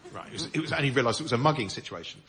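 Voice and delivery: ordinary talk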